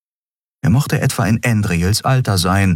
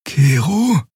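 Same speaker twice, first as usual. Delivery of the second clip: higher questioning call; Xea calling; 9 semitones higher than usual. A narrator's reading. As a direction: companion getting his attention. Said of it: "Xea calls him".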